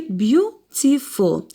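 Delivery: "beautiful" broken into its syllables. Shown as a demonstration. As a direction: sound out "beautiful" one syllable at a time. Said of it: "how many syllables?".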